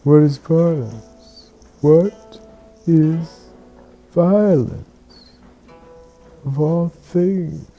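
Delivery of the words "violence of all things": ordinary delivery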